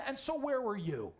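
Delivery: high, strained, weary lament; despairing Elijah. Acted. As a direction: sound like a man ready to quit. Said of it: "what he said to him".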